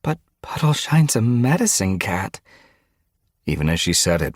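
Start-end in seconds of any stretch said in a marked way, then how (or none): 0.0-2.4 high-pitched, worried objection; protest, clinging to hope; anxious protest. A narrator's reading. Frostpaw insisting the captive is protected, anxious protest.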